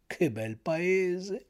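soft, very drawn-out marvelling; soft, slow blissful sigh of words; overjoyed relish. A narrator's reading. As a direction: savour it slowly and quietly, overcome with delight. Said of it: "sputando dalla soverchia consolazione".

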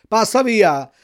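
loud, threatening bark at the dog; threatening shout. A narrator's reading. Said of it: "gli gridò il pescatore minacciandolo". (grido minaccioso)

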